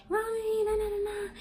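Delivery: put-on high, whiny little-kid wail; high child's whimper calling mommy; child crying out. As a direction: cry out for mommy like a small child.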